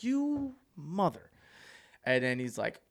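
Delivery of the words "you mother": mock betrayed curse. Friend fled leaving him.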